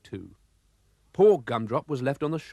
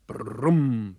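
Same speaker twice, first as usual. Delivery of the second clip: happy engine hum. Acted. Gumdrop arriving at the sea, a content engine rumble. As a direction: purr like a satisfied old car. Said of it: "brrrum went his engine".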